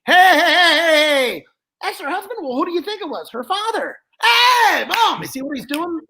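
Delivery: puppet crowing over his own joke; Joey the dummy cackling. Acted. Dummy laughing at the husband in the crowd, high and crowing.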